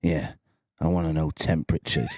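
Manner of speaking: low, deadpan colleague voice; Johnny Hammers. Retold or imitated. Coworker explaining his odd wish, low and deadpan. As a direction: lightly imitate a coworker confiding something daft. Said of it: "he goes".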